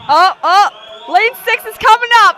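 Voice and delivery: shouting with excitement; excited shouting. Real, not acted. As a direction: cheer the swimmer on loudly. Exciting race finish, commentators cheer.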